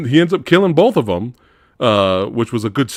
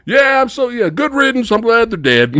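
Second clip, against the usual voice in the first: quirky uncle; 10 semitones higher than usual. Retold or imitated. Uncle thanking the hero for killing nephews, high giddy. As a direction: cheer it like a giddy oddball. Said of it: "the guy's so quirky".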